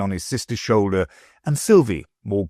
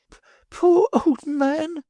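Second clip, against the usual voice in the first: choking with sobs; slower than usual. A narrator's reading. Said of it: "half choking with sobs".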